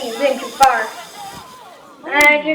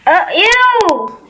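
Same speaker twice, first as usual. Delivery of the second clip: high, loud disgusted ew; 8 semitones higher, 4 dB louder, much slower than usual. Real, not acted. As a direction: recoil with a loud ew. Disgust at the screen.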